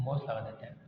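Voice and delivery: ordinary delivery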